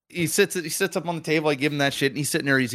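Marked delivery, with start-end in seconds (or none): none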